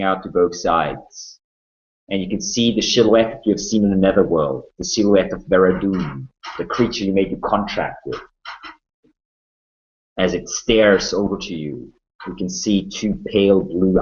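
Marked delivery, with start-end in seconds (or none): none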